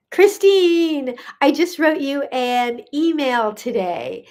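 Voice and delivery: name called out high and drawn out; slow, happy call to a friend, settling down; delighted greeting. Real, not acted. Host spotting Christine on the live, delighted. Spots a known viewer arriving.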